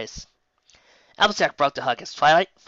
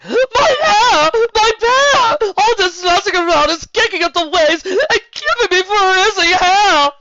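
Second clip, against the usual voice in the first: much higher, loud frantic wail; frantic wailing cry; 11 dB louder, slower than usual. A narrator's reading. Rarity to the group, loud high wailing. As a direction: wail over the ruined hair.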